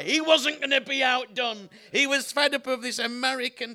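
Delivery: loud, amused relish of a punchline; delighted exclaiming. Real, not acted. Preacher savours the cabbie's great reply, loud delighted exclaiming. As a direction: exclaim it loudly, laughter in the air.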